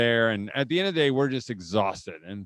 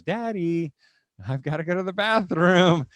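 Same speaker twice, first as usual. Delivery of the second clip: imitated little girl; 6 semitones higher, 5 dB louder than usual. Little daughter interrupting Dad's first bite, pleading. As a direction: mimic a little daughter needing Dad.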